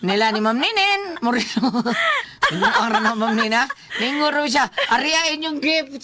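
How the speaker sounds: ordinary talk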